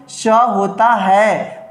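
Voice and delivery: स होता है drawn out very slowly; slow, stressed स होता है; emphatic s. Shown as a demonstration. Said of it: "बहुत इंपॉर्टेंट". (स होता है पर ज़ोर, बहुत धीरे)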